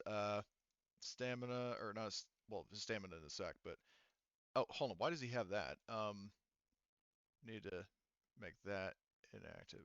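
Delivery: ordinary talk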